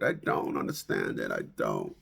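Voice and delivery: very low, soft I don't understand it; deep mumble. Acted. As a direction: drop to a deep quiet grumble. Repeating line in varied voices.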